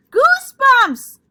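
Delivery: high, loud, drawn-out yell. Acted. Sheldon yells to Lucille that he has goosebumps, very high and loud. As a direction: shout it out in triumph. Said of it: "yelled Sheldon".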